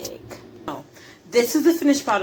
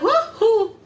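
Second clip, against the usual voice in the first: surprised; 12 semitones higher, 6 dB louder than usual. Retold or imitated. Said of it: "And I'll just be like".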